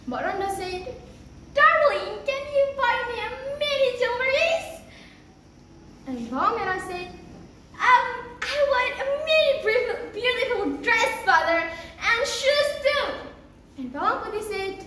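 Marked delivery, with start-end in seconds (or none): 1.5-4.7 high, sugary asking; wheedling wife. Acted. Stepmother wheedling husband. 7.8-13.0 high, whiny begging for things; spoilt daughter. Acted. Spoilt stepdaughter asks for gifts.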